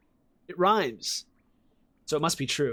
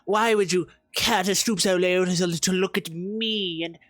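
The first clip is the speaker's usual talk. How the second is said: grovel like a lowly worm; 3 semitones higher, slower than usual. Retold or imitated. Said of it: "It's like".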